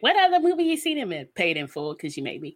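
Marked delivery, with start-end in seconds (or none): 0.0-1.2 a quick higher mock quiz; higher, fast challenging quiz. Friend quizzing her about his movies, fast mock challenge. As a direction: test her like a know-it-all. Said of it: "you tried to play me and be like".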